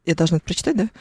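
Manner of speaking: normal talking voice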